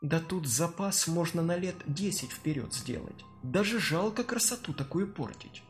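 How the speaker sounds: soft, greedy undertone; quiet scheming thought. Acted. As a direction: savour it quietly. Olesya's greedy inner thought.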